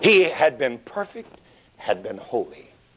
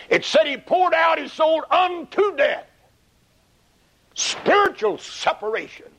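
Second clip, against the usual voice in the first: preaching shout. Real, not acted. Preacher stresses unto death.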